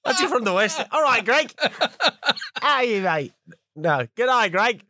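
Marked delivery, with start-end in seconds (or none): 0.9-1.5 high put-on Australian greeting; Australian accent. Podcaster greets the Australian listener, Australian accent. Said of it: "doing an Australian accent". 2.6-4.8 high put-on Aussie greeting; Australian accent. Podcaster hams up hello to Greg, Australian accent. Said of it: "doing an Australian accent".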